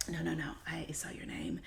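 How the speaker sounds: soft unvoiced whisper of a reassuring manager. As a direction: breathe it out in a hushed whisper. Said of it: "I was just whispering just now".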